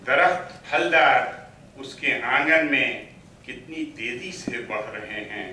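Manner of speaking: declaimed Urdu verse, deliberate pauses between phrases; poetry recital. Acted. Poet reciting to an appreciative mushaira audience, poetry recital. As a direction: swell on repeated lines, ease down on the refrain.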